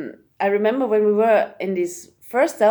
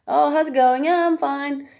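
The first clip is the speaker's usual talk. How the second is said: higher-pitched throwaway greeting and reply; casual small talk; 6 semitones higher than usual. Acted. Imitating an automatic exchange of greetings, casual and perfunctory. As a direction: toss off a mock routine exchange. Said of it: "It's not just like ... like this".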